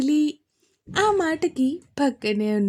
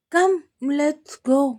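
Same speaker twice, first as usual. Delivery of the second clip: lightly voiced little boy; 4 semitones higher, 5 dB louder, much slower than usual. Vihaan's child voice.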